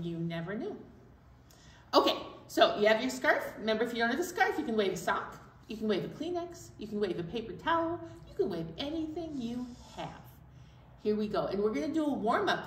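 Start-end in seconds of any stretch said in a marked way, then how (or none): none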